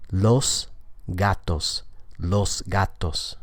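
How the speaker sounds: "Los gatos" slowly, each final s kept voiceless; without assimilation. Shown as a demonstration. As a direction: separate "Los gatos", with a crisp unvoiced s before the g. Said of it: "this sounds like without assimilation".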